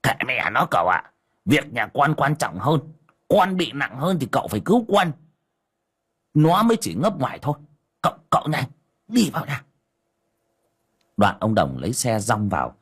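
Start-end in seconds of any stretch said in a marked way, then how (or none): 0.0-9.6 chattering like a myna. Acted. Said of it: "mồm như chim sáo".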